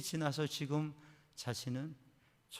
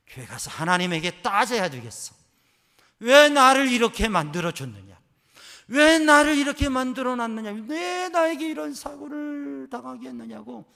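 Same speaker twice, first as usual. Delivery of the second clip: loud, much higher demanding why; angry protest; 10 semitones higher, 16 dB louder than usual. Retold or imitated. Daughter protesting to God before church, angry and loud. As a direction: hurl the question at God. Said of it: "하나님 앞에 따질 거야".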